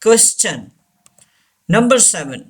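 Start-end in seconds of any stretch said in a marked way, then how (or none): 0.0-0.6 "question" said as kwes-chun. Shown as a demonstration. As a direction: give the T in "question" a ch sound. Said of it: "Say this word". ("question" में टी का "च" sound)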